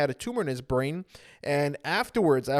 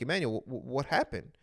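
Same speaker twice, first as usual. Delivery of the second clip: soft, halting worried question; mild worried hush; 5 semitones lower, 7 dB quieter than usual. Retold or imitated. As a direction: ask gently, a little shaken. Family shocked, asking softly.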